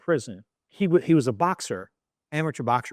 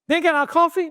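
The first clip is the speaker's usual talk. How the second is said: much higher, louder incredulous cry; mock disbelief; 7 dB louder than usual. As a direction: squeal it in shock. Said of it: "So I see my boys like".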